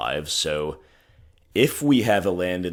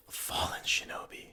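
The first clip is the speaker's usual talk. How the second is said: whispered dismay; 11 dB quieter than usual. Real, not acted. Dismayed at opponent's card.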